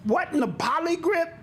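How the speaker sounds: shocked. Retold or imitated. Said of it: "I said".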